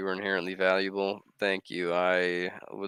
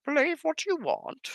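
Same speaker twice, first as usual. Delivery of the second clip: very high squeaky put-on voice; high mock voice. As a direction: squeak it out teasingly.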